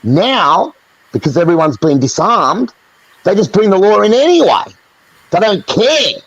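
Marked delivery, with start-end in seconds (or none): 3.2-6.2 indignant rant. Real, not acted. Ranting about the government.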